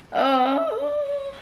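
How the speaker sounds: high emotional gasp of oh; choked-up awe; real, moved exclamation; overwhelmed. Real, not acted. Overwhelmed by the book.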